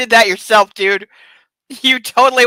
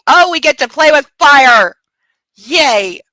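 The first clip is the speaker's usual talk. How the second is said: loud, higher-pitched gleeful outburst; mock excitement; 5 semitones higher, 8 dB louder, slower than usual. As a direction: cheer like a thrilled kid. Mock glee interrupting the reading.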